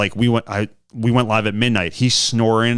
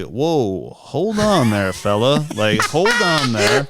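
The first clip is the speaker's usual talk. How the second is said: imitating a slow Southern drawl, stretched out; mock an Alabama caller, dragging it out; 2 semitones higher, much slower than usual. Mimicking slow Alabama phone callers, mock Southern drawl. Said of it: "talking really slow".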